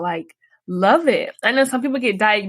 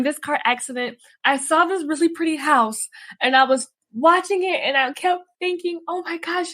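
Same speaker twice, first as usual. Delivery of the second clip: high, tearful voice, near crying; choking up; 6 semitones higher than usual. Real, not acted. Guest telling host about her crash, tearful and high. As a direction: fight back tears as you tell it.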